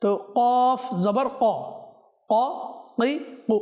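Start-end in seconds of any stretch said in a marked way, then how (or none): 1.4-3.5 ক কি কু, the light wrong vowels; wrong vowel sounds. Shown as a demonstration. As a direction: say ক কি কু to show the mistake. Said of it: "আপনি আমার সঙ্গে অনুশীলন করুন". (ভুল উচ্চারণ: ক কি কু)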